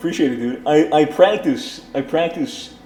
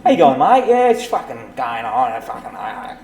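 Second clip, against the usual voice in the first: hammed-up Australian greeting; Aussie accent; 4 semitones lower than usual. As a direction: put on a thick Aussie accent. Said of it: "You do a pretty good Aussie accent".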